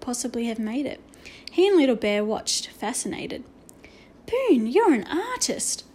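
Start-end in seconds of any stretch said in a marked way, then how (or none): 4.3-5.8 high, slow, swooping amazement; breathed in awe. A narrator's reading. Omri marvelling at Boone's tiny drawing, awed. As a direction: marvel slowly, pitch lifted high. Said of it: "Omri breathed at last".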